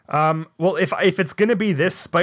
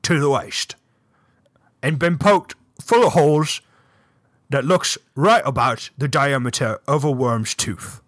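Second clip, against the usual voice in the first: halting, wandering attempt at British; badly faked British sleuth explaining clues; shaky British accent. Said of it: "British just wanders all over the place".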